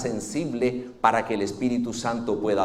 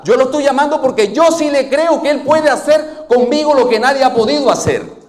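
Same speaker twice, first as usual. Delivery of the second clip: higher, louder, shouting his faith; defiant shout; 11 semitones higher, 8 dB louder than usual. Acted. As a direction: cry out that you believe more than they do. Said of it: "Bartimeo tenía ganas de decirle así".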